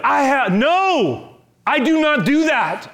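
high, loud protest; loud indignant refusal. Acted. Peter refusing the Lord, loud and high. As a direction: push back hard, almost shouting.

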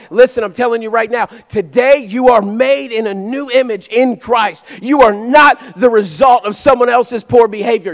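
loud, driving sermon delivery; full-force preaching. Real, not acted. Preacher urges congregation to drop the past, forceful preaching. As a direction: preach it hard and loud, hammering key words.

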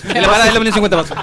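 fast disclaimer read; quick end-of-ad legal line; rapid legal small print. Shown as a demonstration. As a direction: rattle it off like fine print. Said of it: "esa locución rápida al final".

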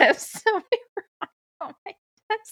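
high, laughing, rising voice; high-pitched voice. Pretend. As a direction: keep it up high and giggly. Keeps high laughing register.

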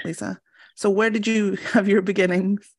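ordinary delivery

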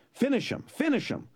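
high, sharp repeated order; peeved order. A narrator's reading. Sicilian ordering Fezzik to kill, high and peeved. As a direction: snap the order twice. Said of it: "getting peeved".

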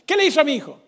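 demanding father. Retold or imitated. Machete man demands answers.

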